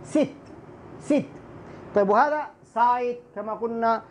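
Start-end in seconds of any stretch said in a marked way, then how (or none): 0.0-1.2 ست as "sit", quick short i. Shown as a demonstration. Teacher likens kasra to fast ya, short ست. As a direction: clip the vowel of ست short. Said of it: "بس ياء سريع".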